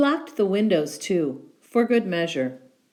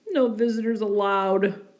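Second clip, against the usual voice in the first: sniffling bear; 3 semitones higher than usual. Acted. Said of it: "he sniffled".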